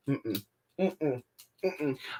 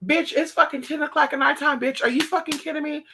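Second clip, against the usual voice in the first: high, fast exasperated friend; friend's exasperated reply; 11 semitones higher than usual. Retold or imitated. Friend answering her panicked call, high fast exasperation. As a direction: roll your eyes and fire it back quickly. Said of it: "And they're like".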